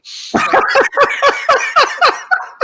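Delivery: high loud burst of laughter; big laugh. Real, not acted. Seahawks fan laughing off a departed coach, loud laugh. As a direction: let out a big cackling laugh.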